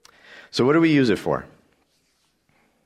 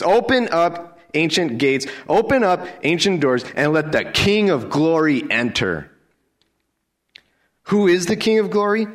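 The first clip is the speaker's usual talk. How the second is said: much higher and louder, ringing out; triumphant proclamation; 9 semitones higher, 4 dB louder than usual. Real, not acted. Preacher calling out the psalm to the congregation, ringing and loud. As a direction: proclaim it like a herald.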